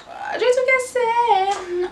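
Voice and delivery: high, drawn-out wail; long, high-pitched cry of dismay. Real, not acted. Dismayed at breaking something.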